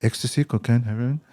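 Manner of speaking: lightly voiced hawker, offering item by item; street dealer, repeating. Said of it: "I can't understand what you're saying".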